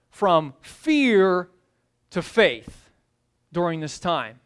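ordinary delivery

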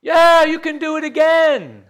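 high, loud eager reply; crowd shouting back. Retold or imitated. Crowd yelling back to the walker, loud and eager. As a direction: answer like a cheering crowd.

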